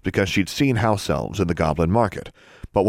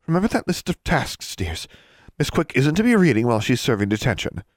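higher-pitched lady librarian voice; prim librarian; 6 semitones higher than usual. A narrator's reading. Librarian assigning a student's detention work, prim high voice. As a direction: give orders as a librarian.

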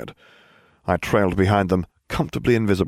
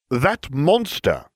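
sniffled; 7 semitones higher, much slower than usual. A narrator's reading. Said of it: "the hulking boy sniffled".

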